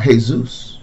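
"Jesus" said as hay-ZOOS; Spanish pronunciation. Shown as a demonstration. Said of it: "as some people pronounce it".

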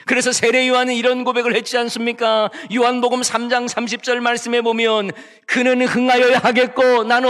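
rally the hearers into the verse; emphatic preaching. Acted. Pastor quoting John the Baptist to the church, emphatic preaching. Preacher builds to scripture quote.

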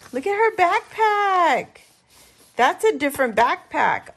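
high, loud squeal of delight; excited squeal. Real, not acted. Crafter spotting a character's backpack, squealing excitedly. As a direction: burst out at the cute discovery.

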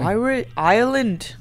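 badly faked Irish accent; failed Irish accent. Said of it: "I can't do Irish accent".

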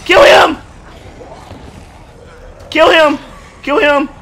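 high, loud battle cry; shouting. Real, not acted. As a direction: yell the order at full volume. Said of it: "killing you guys' eardrums".

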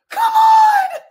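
very high, loud shriek; exasperated scream. Real, not acted. Fan who has to wait another week, exasperated scream. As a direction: let frustration explode into a scream. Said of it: "wondering why I'm yelling".

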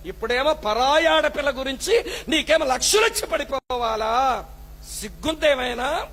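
ordinary delivery

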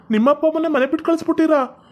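high-pitched, incredulous reproach; appalled scolding. Acted. Parent appalled, scolds the couple. (ಗಾಬರಿಯಿಂದ ಏರಿದ ಧ್ವನಿಯಲ್ಲಿ ಬೈಗುಳ)

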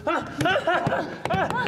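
short repeated terror screams; demo screams. Shown as a demonstration. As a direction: scream in bursts to model it. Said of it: "Eso, venga, sí".

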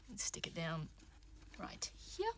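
usual speaking voice

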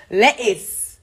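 "Lettuce" as le'iss with a glottal stop; no T's. Shown as a demonstration. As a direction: drop the middle T in "Lettuce". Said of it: "No T's".

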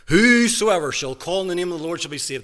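preaching at full force. Acted. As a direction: proclaim the verse to the hall. Quoting scripture at full force.

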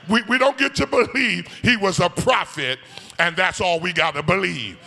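loud, high sung whoop of a preacher; climbing sung sermon whoop; preaching at full force. Acted.

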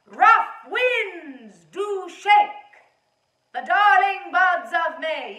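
loud, slow shout of "Rough winds do shake"; loud called-out recitation; calling across a lake. Shown as a demonstration. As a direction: project the line to a friend far across the water. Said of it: "Loud. as if you are talking to your friend across a large, large lake".

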